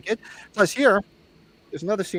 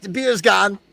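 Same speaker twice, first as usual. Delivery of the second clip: raised, loud mock-dramatic cry; parody of film line; 8 semitones higher, 7 dB louder, slower than usual. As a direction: declaim it like a movie hero in alarm. Parodying film line about drunken night.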